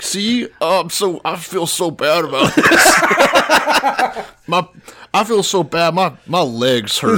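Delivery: high, pitiful mock whine; Harvey Weinstein. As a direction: play up feeling sorry for yourself and your aching legs. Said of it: "like how Harvey Weinstein's walking around with a walker".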